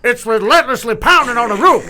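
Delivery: high, loud play-by-play; hammed-up dramatic announcer call. Commentator calling rain on a roof, excited mimicry. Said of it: "us doing J R impressions".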